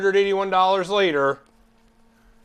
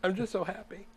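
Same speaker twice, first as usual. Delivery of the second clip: soft, higher pretend weepiness; mock tearful; 4 semitones higher, 11 dB quieter than usual. Joking about watering eyes, mock tearful with happiness. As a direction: play at choking up with joy. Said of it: "he's tearing up over it".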